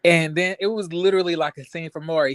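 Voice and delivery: normal talking voice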